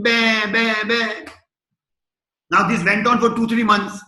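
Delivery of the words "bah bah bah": lion cub copying the sheep's bleat; cub trying to bleat. Retold or imitated. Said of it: "So the lion started thinking".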